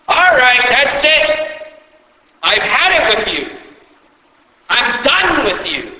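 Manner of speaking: high, loud exasperated scolding, building; hammed-up fed-up teacher voice; mock exasperated rebuke. Preacher voicing a fed-up Jesus scolding Moses, exasperated. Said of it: "Jesus does not say".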